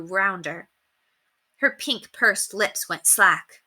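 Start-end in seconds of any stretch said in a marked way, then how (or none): none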